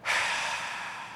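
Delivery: a long, heavy, put-upon sigh; exaggerated sigh. Shown as a demonstration. Preacher demonstrating grumbling to the congregation with an exaggerated sigh. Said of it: "a person can grumble just by doing this".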